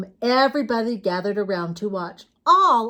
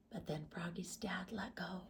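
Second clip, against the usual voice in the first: breathy storyteller whisper; whispering the twist to young listeners; suspense whisper; 3 semitones lower, 22 dB quieter than usual. A narrator's reading. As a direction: hush them for the big moment. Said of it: "whispered suspense".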